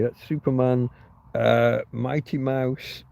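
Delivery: ordinary delivery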